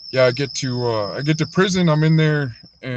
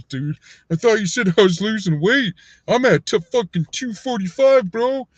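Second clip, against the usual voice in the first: higher, louder indignant complaint; pissed-off retort; 9 semitones higher, 5 dB louder than usual. Retold or imitated. Angry gym client protests his weight to trainer. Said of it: "I got all pissed off and went".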